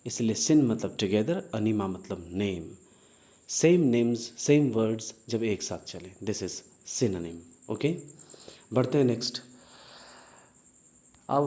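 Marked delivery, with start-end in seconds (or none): none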